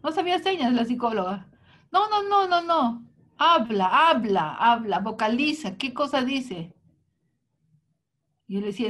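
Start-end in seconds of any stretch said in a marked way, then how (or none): 1.9-6.7 mock psychologist demanding he talk; insistent psychologist. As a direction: push him to speak aloud. Retold psychologist insisting he speak.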